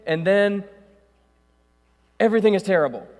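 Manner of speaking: loud, high, driving sermon delivery; preaching at full force. Acted. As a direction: preach it hard to the room.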